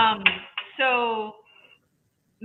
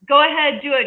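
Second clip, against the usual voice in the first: eager yes; 6 semitones higher, 7 dB louder than usual. Retold or imitated. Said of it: "and they're like".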